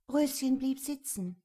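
usual speaking voice

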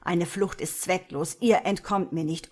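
loud, hard warning that there is no escape; angry, forceful declaration; roared threat. A narrator's reading. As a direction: bellow it as a menacing order. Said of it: "brüllte er".

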